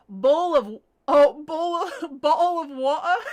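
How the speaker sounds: mock British with dropped T's, loud; British accent. Shown as a demonstration. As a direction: play up a British glottal-stop "bowl of water". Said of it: "how to speak in a British".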